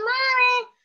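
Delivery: put-on high, pleading child voice; little girl begging mommy; imitating little daughter. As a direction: whine it like a small kid asking for a cookie. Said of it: "My younger daughter is like".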